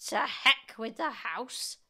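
put-on slow, loud, high old woman; defiant shout. A narrator's reading. Grandma dismisses George's warning, defiant shout. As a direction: shout it with drawn-out scorn. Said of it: "she shouted".

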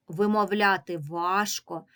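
usual speaking voice

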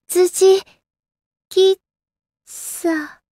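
high, broken into separate syllables; drawn-out faltering. Acted. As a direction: let the word trail away in pieces. Drawn-out broken word, butler cafe.

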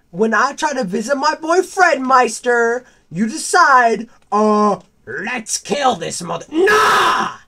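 high, loud accusing shout; loud betrayed tirade at Foxy, breaking into cries; furious yelling. Acted. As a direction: scream at him like a betrayed friend. Yelling at Foxy for killing him.

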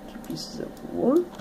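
high whispered count. Real, not acted.

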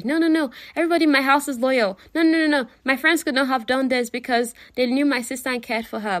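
high, loud, insistent denial; defensive protest. Retold or imitated. James defending his household from suspicion, protesting. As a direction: rush in to defend, pitched high. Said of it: "was trying to defend everybody".

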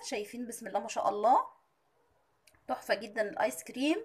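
normal talking voice

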